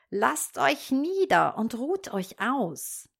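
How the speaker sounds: höher und langsam, falsch freundlich schnarren; schnarrende Hexe. A narrator's reading. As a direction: eine Hexe, die ihre Gäste einlullt. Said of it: "schnarrte sie und tat ganz freundlich". (langsame, höhere Hexenstimme, gespielt freundlich)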